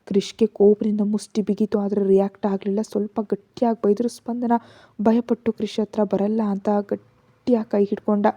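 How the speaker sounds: ordinary delivery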